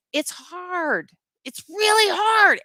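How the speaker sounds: slow, loud and high, voice straining; a real burst of frustration, rising high; emotional outburst. Real, not acted. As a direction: cry it out from the gut. Emotional plea to her viewers.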